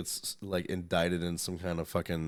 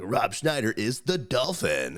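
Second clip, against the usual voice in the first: South Park quote; 4 semitones higher than usual. Retold or imitated. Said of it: "even fucking South Park made a skit".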